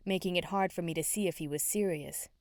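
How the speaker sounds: plain reading voice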